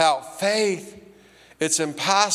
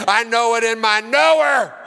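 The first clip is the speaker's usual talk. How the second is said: excited preacher; 6 semitones higher, 5 dB louder than usual. Retold or imitated. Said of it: "preachers get up and get all excited".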